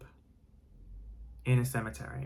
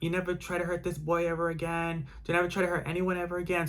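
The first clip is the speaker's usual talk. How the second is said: sustained high retold shouting; retold screamed threat; 5 semitones higher than usual. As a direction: imitate rescuers screaming a warning, volume held back. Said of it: "the people were screaming".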